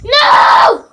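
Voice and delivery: high loud panicked shouts; panicked screaming. Real, not acted. Panicked screaming as character dies.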